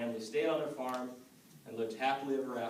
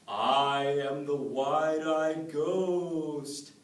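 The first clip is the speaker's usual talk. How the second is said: slow, drawn-out, louder ghostly moan; spooky ghost voice; 4 semitones higher, 10 dB louder, much slower than usual. Acted. Ghost haunting the woman at the milking stool, slow spooky moan. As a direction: stretch every word like a haunting ghost. The same voice comes and says.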